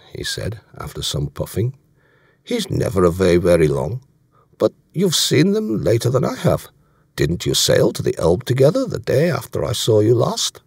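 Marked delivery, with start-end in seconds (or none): 2.4-10.6 put-on German-accented officer asking questions; German accent, lifting higher into the question; German commander, probing. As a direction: probe casually in a German accent. Voicing German commander in dialogue.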